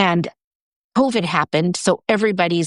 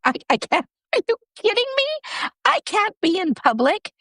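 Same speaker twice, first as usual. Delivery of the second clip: much higher, mock-panicked protest; retold panic; 13 semitones higher than usual. To her friend about comedy class, a high mock-panicked protest. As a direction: squeak out a frightened refusal.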